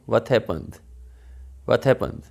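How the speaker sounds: quick linked. Shown as a demonstration. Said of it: "जितना जल्द बोलेंगे, उतना ही अच्छा होगा".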